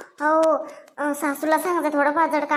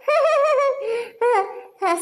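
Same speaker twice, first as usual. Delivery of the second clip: laugh; 7 semitones higher than usual. Acted. Mother laughs at the cold excuse.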